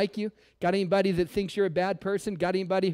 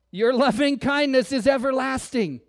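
proclaimed; 5 semitones higher, 6 dB louder than usual. Shown as a demonstration. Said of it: "Say it again".

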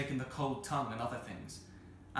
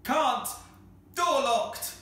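high, spaced-out bellow; slow, loud shout; 13 semitones higher, 10 dB louder, much slower than usual. A narrator's reading. Cyril answers down from locked tower, slow loud shouts. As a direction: shout back in short separate bursts. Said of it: "the same voice replied".

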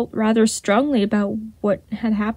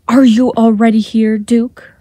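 cool voice; 6 dB louder than usual. A narrator's reading. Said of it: "a cool voice interrupted".

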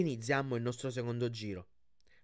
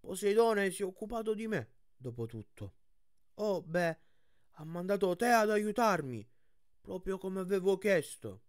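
higher-pitched character voice for Tyson, earnest; high, guileless cyclops voice; earnest young cyclops; 6 semitones higher than usual. Acted. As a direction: voice a simple-hearted cyclops, pitched up.

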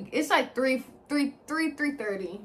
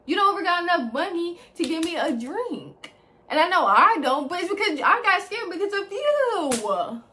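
higher and louder, heated and accusing; angry confrontation; 6 semitones higher, 7 dB louder than usual. Retold or imitated. Telling Croc off for not buying a drink, furious. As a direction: let the anger build as you blame him.

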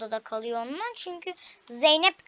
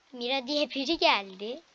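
ordinary talk

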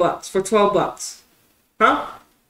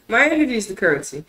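ordinary talk, nothing marked

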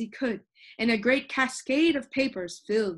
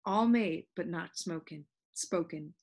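usual speaking voice

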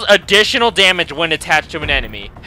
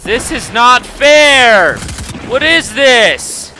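loud, high, slow whine; whining protest; 6 semitones higher, 6 dB louder, much slower than usual. Real, not acted. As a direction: stretch each word in protest. Complaining about unfair game.

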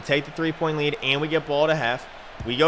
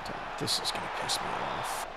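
muttered under breath; 9 dB quieter than usual. Real, not acted. Muttering over a bad play.

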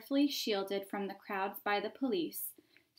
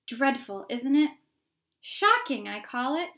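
shocked onlooker; 4 semitones higher, 7 dB louder than usual. Acted. Crowd onlooker tutting.

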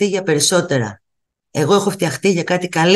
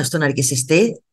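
ordinary talk

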